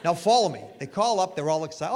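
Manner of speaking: ordinary talk, nothing marked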